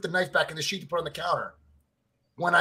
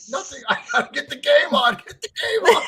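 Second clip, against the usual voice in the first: higher and louder, laughing through it; laughing mock shout; 12 semitones higher, 4 dB louder than usual. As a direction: yell it out, cracking up. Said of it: "And I'm like".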